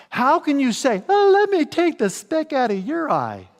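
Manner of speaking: mock hypocrite. Preacher mocks the hypocrite's pious offer, high. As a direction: sneer it like a fake helper.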